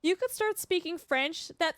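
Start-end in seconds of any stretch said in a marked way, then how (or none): none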